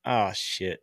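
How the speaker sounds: low, soft sinking reaction; retold dismay. Retelling seeing the injury news on Twitter, low dismay. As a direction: let it drop, quietly dismayed.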